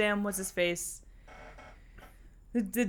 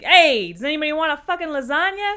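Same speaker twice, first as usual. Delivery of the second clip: loud Italian host; 7 semitones higher, 6 dB louder than usual. Retold or imitated. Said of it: "They're like".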